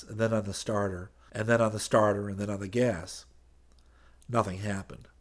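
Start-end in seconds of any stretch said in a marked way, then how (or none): none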